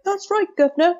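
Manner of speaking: high-pitched hammed-up fake British accent; terrible fake accent. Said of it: "with a very terrible fake accent".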